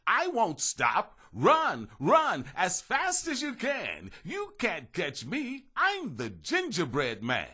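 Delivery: high, loud, slow singsong taunt; taunting yell. A narrator's reading. Gingerbread man taunting the children, high slow singsong. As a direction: gloat and chant at the chasers. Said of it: "He yelled".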